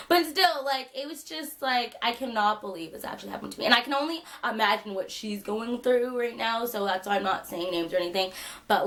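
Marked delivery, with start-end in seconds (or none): none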